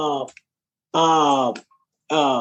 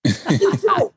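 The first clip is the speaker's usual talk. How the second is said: cheerful fan; 10 semitones higher than usual. Retold or imitated. Fan answering her.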